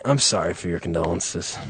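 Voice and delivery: low, hushed deadpan sympathy; mock condolence. Comic rehearsing his line for the bereaved, hushed mock sympathy. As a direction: offer it very softly and low. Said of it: "That's what I'm gonna start saying".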